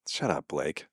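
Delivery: low, soft shut up; quiet dismissal. Real, not acted. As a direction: mutter it under your breath. Quiet aside at commenter.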